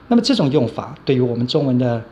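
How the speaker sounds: ordinary delivery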